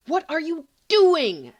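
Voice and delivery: loud, slow, furious outburst; exploding in anger. Acted. Sirius exploding at Remus, loud and slow. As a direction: blow up at him, each word hammered. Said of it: "Sirius explodes".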